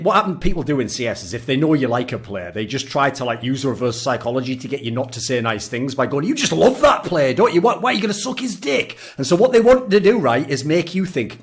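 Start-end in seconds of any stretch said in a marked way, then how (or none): 6.3-9.0 higher-pitched taunting questions; imitated jeering accusation; mock goading. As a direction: needle them like an accuser. Voices people goading him about bias.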